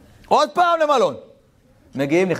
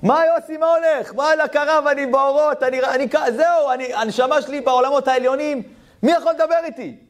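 ecstatic; 12 semitones higher than usual. Retold or imitated. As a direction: float in spiritual bliss. Yossi ecstatic on phone. (התלהבות גבוהה ורמה)

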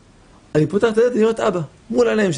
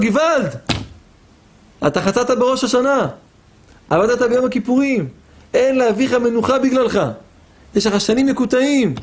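raised, higher mock shout of rebuke; retold shouting; 6 semitones higher than usual. The Chazon Ish rebukes the visitor, a retold higher shout. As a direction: cry out the rebuke in a pitched-up retold yell. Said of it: "הוא התחיל לצעוק".

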